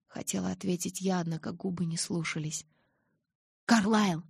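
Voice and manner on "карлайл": higher, louder cry for help; desperate call. A narrator's reading. Angel calling Carlisle for help, desperate. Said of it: "отчаянно позвал ангел".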